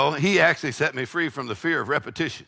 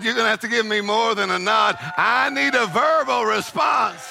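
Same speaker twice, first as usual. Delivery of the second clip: God demanding; 5 semitones higher than usual. Acted. Preacher voicing God, building to a shout.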